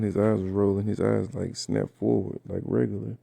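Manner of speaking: ordinary talk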